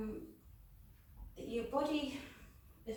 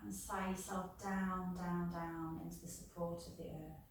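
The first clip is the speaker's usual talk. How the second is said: calm yoga nidra guidance with long pauses; guided meditation. Real, not acted. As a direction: ease listeners down into rest, phrase by phrase.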